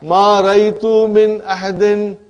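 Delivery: slow, loud model sentence with stress on min; teacher modelling the example slowly and loudly; slow emphatic example. Shown as a demonstration. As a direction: spell out ma raitu min ahadin, leaning on min. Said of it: "Sister, brother and sister".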